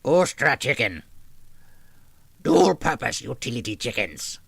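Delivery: a loud goblin growl with a strange throat. Acted. Goblin voice for the chat's chicken article, loud. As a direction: snarl it like a cave goblin. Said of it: "I will try to do something that's goblin-esque".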